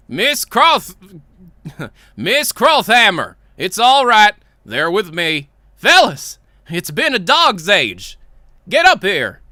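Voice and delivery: loud, drawn-out greeting voice; hearty greeter. Acted. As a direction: welcome old friends with booming confidence. Boss greets visitors in story.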